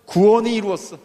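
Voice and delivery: loud preacher's proclamation of 구원이 이루었어; proclaimed loudly. Shown as a demonstration. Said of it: "오늘 이 집에 어떻게 됐어요?".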